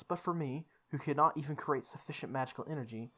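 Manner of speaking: usual speaking voice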